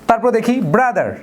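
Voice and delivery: normal talking voice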